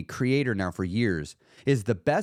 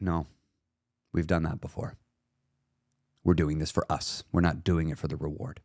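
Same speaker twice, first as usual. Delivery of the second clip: firm calm self-talk; 7 semitones lower than usual. Pretend. Answering the inner voice.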